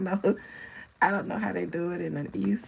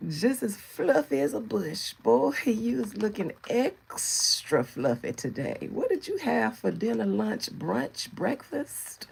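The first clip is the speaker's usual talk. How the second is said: put-on higher, slow teasing old-lady voice; shady old lady; 4 semitones higher, slower than usual. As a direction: poke fun like a sassy grandma. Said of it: "I'ma let y'all young girls have it".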